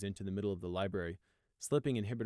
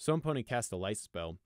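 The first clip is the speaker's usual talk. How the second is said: ordinary delivery, nothing marked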